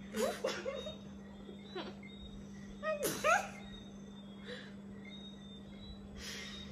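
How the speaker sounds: breathless laugh. Real, not acted. Laughing at sister.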